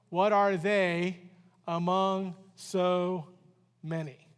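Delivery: slow, measured Bible reading; reading the verse aloud to the church, slow and measured; scripture reading. Acted. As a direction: read the verse slowly and deliberately.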